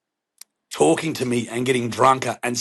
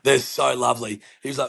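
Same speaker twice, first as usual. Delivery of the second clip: usual speaking voice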